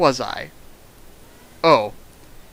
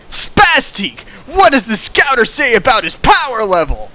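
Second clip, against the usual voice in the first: higher and louder, mock urgent; hammed-up shout; 7 semitones higher, 7 dB louder than usual. Fan asking his co-host for the scouter reading. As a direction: call out like an anime hero.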